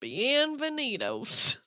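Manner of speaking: very slow country drawl; country-accent catchphrase. Retold or imitated. Breaking the silence in line with the joke, slow country accent. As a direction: stretch the Spanish word right out, country style. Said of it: "and then just".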